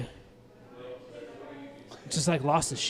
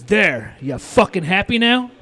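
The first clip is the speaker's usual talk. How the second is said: imitating a defiant customer, louder and higher; drawn-out, raised-voice retort from a fed-up customer; retold angry customer; 6 semitones higher, 9 dB louder, slower than usual. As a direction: throw it out as a loud, mock-angry challenge. Customer snapping after removing mask.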